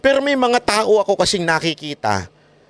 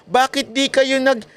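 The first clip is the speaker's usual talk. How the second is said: mocking taunt; 7 semitones higher than usual. Taunting supporters who switched sides.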